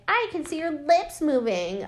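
put-on high-pitched heckling kid; bratty boy. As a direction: heckle from the audience as a little brat. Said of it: "this little shit is like".

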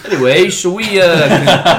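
high, loud, through laughter; laughing. Real, not acted. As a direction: try to move on while cracking up. Moving on while cracking up.

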